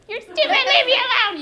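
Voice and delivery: high-pitched, loud mock yell; acted angry child. Upset child to teacher in role-play, hammed-up angry shout. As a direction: shout it like a kid having an outburst. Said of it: "Tell me I'm stupid".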